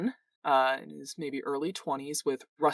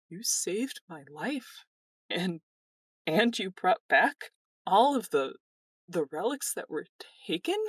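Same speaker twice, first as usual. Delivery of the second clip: higher, halting voice of awed gratitude; tearful, grateful young man; 5 semitones higher than usual. Acted. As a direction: sound moved, close to welling up. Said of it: "start kind of welling up".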